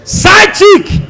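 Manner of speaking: high, loud punchline shout. Acted. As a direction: hit the punchline hard.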